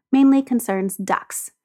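ordinary delivery, nothing marked

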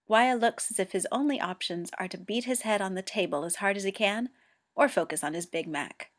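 ordinary delivery

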